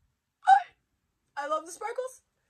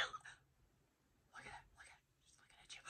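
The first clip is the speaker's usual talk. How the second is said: awed whisper; 28 dB quieter than usual. Real, not acted. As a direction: breathe it out over a treasured gift. Awed over the doll.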